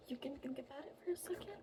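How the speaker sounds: quick unvoiced whisper; fairy whisper. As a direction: whisper the invitation.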